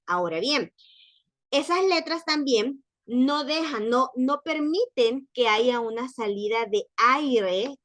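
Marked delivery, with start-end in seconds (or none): none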